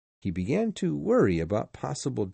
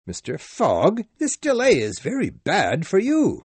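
high, loud concerned general; worried general; 8 semitones higher, 5 dB louder than usual. Acted. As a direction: warn him of the delay. Sir Francis worried.

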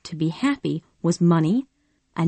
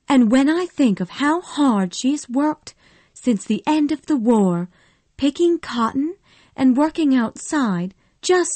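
higher, earnest defending wife; pleading Melanie; 6 semitones higher than usual. Acted. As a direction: plead for her friend, pitched up.

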